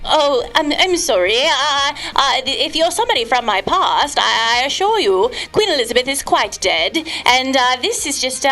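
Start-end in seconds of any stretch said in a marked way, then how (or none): none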